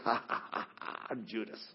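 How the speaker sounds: slow, hushed, menacing whisper; sinister Judas. Retold or imitated. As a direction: breathe it like a cartoon stage villain. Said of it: "and, uh, sinister".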